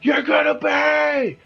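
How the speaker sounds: high, drawn-out furious yell; enraged scream. Acted. Upset dwarf to his boss's killer, high enraged scream.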